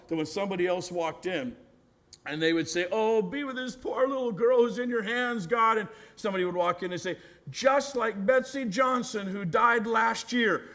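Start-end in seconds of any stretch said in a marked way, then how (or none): 2.9-5.8 higher-pitched mock lament; wailing mourner. Mourner praying over the poor girl, mock wailing. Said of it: "ladies who would wail and cry". 7.5-10.7 slow, high mock dirge; wailing mourner. Mourner working on the villagers' grief, mock wailing. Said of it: "ladies who would wail and cry".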